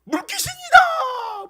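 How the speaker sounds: very high, loud, drawn-out scream of alarm; terrified cry. Acted. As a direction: shriek it like you've seen a ghost. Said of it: "생각하고 소리쳤다". (물귀신을 본 듯 높게 길게 비명)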